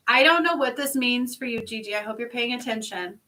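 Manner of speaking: normal talking voice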